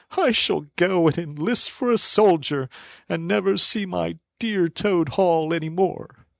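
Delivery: a melodramatic sob story; tearful Toad. A narrator's reading. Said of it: "crying into the sofa cushions".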